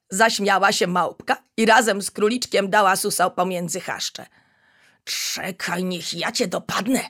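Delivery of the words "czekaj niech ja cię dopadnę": ordinary delivery, nothing marked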